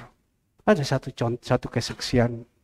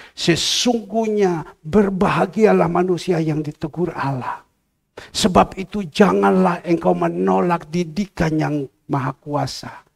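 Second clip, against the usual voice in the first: raised, emphatic preacher's reading; higher-pitched, declaimed Bible verse; scripture reading; 4 semitones higher, 3 dB louder than usual. Acted. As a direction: proclaim the verse to the congregation. Reads scripture to congregation.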